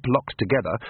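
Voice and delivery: ordinary delivery, nothing marked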